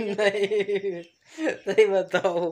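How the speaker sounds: drawn-out pleading through laughter; laughing plea. Real, not acted. Husband laughingly begs his wife to tell the truth. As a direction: beg her slowly while laughing.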